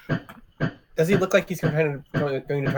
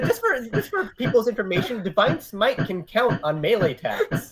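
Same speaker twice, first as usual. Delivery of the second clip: gleeful rules note; 7 semitones higher than usual. Real, not acted. Player gleeful rules reminder.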